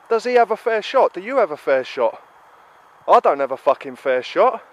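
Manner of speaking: usual speaking voice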